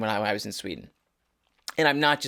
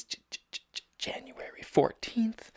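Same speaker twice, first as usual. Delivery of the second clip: whispered promo echo; 10 semitones higher, 6 dB quieter than usual. Pretend. Host plugging album release date, whispered mock echo.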